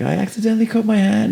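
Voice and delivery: put-on high, timid child voice; high kid voice. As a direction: squeak it like a shy kid. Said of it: "he's like".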